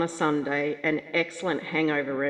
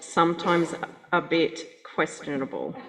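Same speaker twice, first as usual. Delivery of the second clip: normal talking voice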